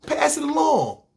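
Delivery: high, shouted preacher call; preachy shout. Acted. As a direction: shout it like the peak of a sermon.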